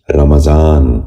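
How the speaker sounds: رمضان said "ramazaan", in three syllables; correct pronunciation. Shown as a demonstration. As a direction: put a short "a" after the m in رمضان. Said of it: "دوبارہ سنیے".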